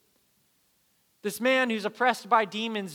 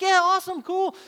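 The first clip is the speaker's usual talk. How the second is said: high, loud bright relief; relieved students; 13 semitones higher, 8 dB louder than usual. Retold or imitated. Students cheering the rescue plan, high and loud. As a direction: toss it off like a relieved, eager kid. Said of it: "And they're just like".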